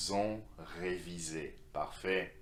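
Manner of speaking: usual speaking voice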